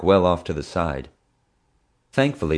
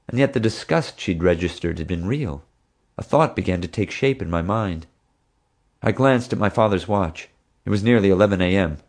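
plain reading voice

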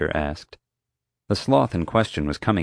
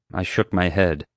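plain reading voice

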